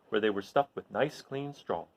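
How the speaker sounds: ordinary delivery, nothing marked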